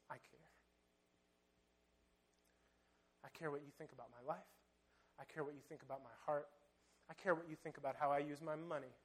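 soft, low, sincere vow; quiet devotion. Acted. The woman tells Jesus she cares, soft and devoted. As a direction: murmur it like a private promise.

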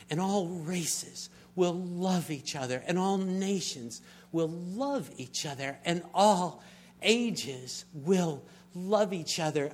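slow, swelling preacher's cadence; preaching climax. Acted.

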